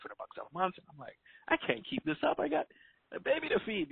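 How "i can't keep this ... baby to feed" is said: exasperated self-quote. Retold or imitated.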